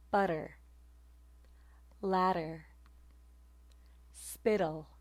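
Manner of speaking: "Butter" with a quick tongue tap for the t; alveolar flap. Shown as a demonstration. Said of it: "a flap of your tongue".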